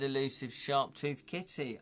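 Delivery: ordinary talk, nothing marked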